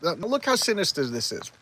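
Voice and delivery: ordinary talk, nothing marked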